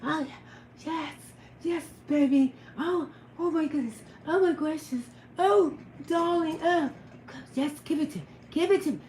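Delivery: breathy mock moans, mostly soft; hammed-up seductive bedroom voice; sexy voice. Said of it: "I just had to put my damn sexy voice on and say".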